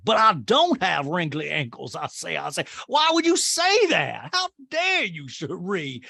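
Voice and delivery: imitating Sutton's Southern indignation; mock Southern belle outrage. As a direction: clutch pearls in mock horror. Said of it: "And Son's like".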